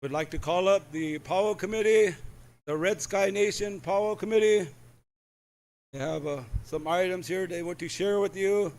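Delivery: loud, carrying announcer voice; announcer call. Acted. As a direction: call it out across the arena. MC calling up committee.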